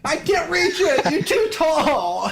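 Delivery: high whiny little gnome. Acted. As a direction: whine up at someone tall. Little gnome can't reach.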